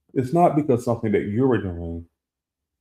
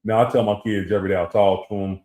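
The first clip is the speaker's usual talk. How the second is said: ordinary talk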